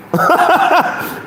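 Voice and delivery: very high burst of laughter; real laugh. Real, not acted. Host wishes he had bet, high laughter. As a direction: cackle at your own regret.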